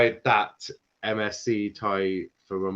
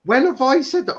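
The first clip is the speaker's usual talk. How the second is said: sharply higher and louder, offended disbelief; indignant protest; 13 semitones higher, 9 dB louder, faster than usual. Real, not acted. Denying it to his co-host, sharply indignant. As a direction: fire back how dare you. Accused of not keeping same energy.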